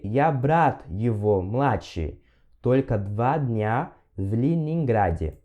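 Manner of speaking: slow, careful Russian repeat. Shown as a demonstration. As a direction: repeat slowly for learners. (repetição lenta)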